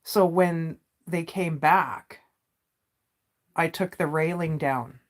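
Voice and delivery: usual speaking voice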